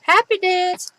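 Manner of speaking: high, loud sing-song cheer; happy dance. Real, not acted. Crafter cheering to herself as the piece dries, in a high sing-song. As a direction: squeal it like a little victory jig. Celebrating while paper dries.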